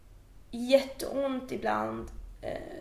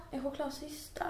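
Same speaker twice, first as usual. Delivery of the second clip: chokladcysta asked back quiet and incredulous; hushed baffled echo of the word chokladcysta; stunned disbelief; 10 dB quieter than usual. Retold or imitated. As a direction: repeat "chokladcysta" softly, unable to believe it. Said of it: "Och jag bara".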